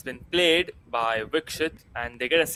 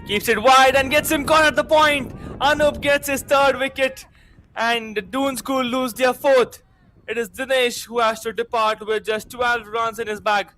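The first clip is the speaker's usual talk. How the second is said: raised, shouting play-by-play; excited commentary; 7 semitones higher, 3 dB louder than usual. Real, not acted. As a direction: call the wicket with a yell. Wicket falls in live commentary.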